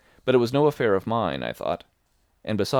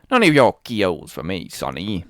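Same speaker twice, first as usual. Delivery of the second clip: put-on loud, wheedling old sea dog; sly old sailor; 8 dB louder than usual. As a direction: coax the boy like a fawning pirate.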